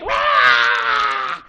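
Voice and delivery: put-on high, loud baby cry; baby wail. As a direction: wail at the rattle and drum. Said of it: "but the baby still cried".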